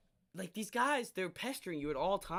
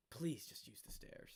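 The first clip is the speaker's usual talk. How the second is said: slow, soft, near-whispered request; quiet exasperated pleading; hushed plea; 2 semitones lower, 9 dB quieter than usual. Real, not acted. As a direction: drop to a slow, pointed hush. Exasperated plea after complaint.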